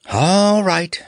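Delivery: high, drawn-out sigh of giving in; theatrical resigned sigh. Acted. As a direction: sigh it out reluctantly, pitch up. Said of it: "sighed Nick".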